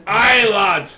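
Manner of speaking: put-on loud hearty Scottish pirate accent. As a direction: bellow it like an old Scottish sea dog. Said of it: "You're pirate, not Scottish".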